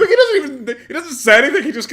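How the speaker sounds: high, loud, laughing disbelief; laughing outburst. Real, not acted. Streamer laughing at the fighter's silent nod, high excited outburst. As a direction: burst out high and loud, cracking up.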